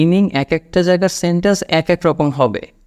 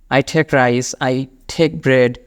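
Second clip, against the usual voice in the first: slow model sentence; much slower than usual. Shown as a demonstration. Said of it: "teacher model".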